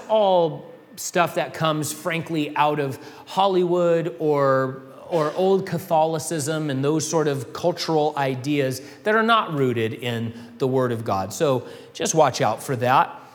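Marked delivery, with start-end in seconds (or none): none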